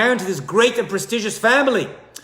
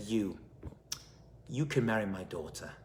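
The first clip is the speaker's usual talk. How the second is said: tender; 9 semitones lower, 15 dB quieter than usual. Acted. Father moved, granting his blessing.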